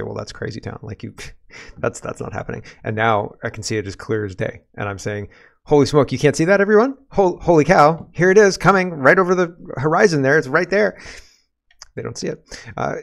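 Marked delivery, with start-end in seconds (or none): 0.0-2.6 soft, half-whispered brush-off; a quiet scoffing retold reply; dismissive aside. Retelling own dismissive reply. 5.6-11.0 louder and higher, urgently pointing it out; half-hammed exclamation of disbelief; alarmed outcry. Retelling own alarmed outcry.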